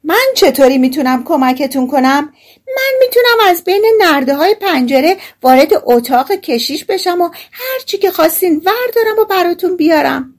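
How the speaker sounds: high, piercing little character voice; shouting. A narrator's reading. Tiny hero offers thieves his help, shouting high. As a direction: pipe up eagerly at a high pitch. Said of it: "بند انگشتی فریاد زد".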